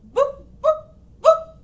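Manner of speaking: very high, loud clucks; clear clucking. Acted. Hen insisting to the librarian, very high clear clucking. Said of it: "clucked the hen, quite clearly".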